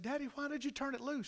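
put-on subdued, high-pitched child's question; little girl. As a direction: ask Daddy in a small, high voice. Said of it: "And she said".

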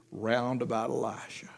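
hushed whisper of wonder; awed whisper. Acted. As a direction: breathe it in awe. Awed scripture reading drops to whisper.

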